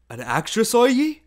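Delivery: rising to a high, loud question; astonished question. A narrator's reading. Old invalid gentleman to his reader, astonished loud question. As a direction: build to an incredulous, high-pitched "are ye?".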